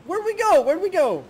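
high, loud and urgent; panic, ask where to land; excited. Real, not acted. Panicked drop in game.

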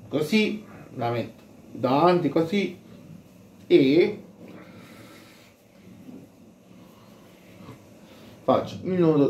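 muffled behind face covering; speaking through a mask; through a cloth bandana. Real, not acted. Seen 0: Red bandana covers mouth and nose while he ties it behind.

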